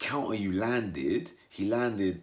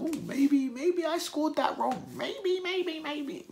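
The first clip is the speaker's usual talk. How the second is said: high, drawn-out doubtful voice; whiny second-guessing voice; mock doubtful judge; slower than usual. Imagined judges doubting, mocking.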